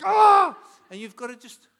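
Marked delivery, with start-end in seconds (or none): 0.0-0.6 very high, loud scream of alarm; panicked shout. Retold or imitated. The whole family screaming in panic after the accident. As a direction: shriek in panic. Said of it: "everyone's like".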